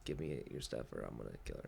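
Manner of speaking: low, quiet mock threat; threatening. Red threatening Silence with a knife at her daughter's throat, low threat. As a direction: drop low and soft to menace. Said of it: "like, threatening silence".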